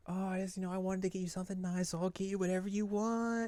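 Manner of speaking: high, soft, quick sweet talk; sweet girly voice. Retold or imitated. Rise offering Yu a gift, high soft sweet voice. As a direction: coax him sweetly.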